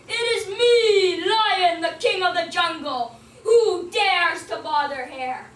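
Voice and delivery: Lion shouts his challenge into the cave, slow and loud; proud lion call. Acted. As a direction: announce yourself like the king.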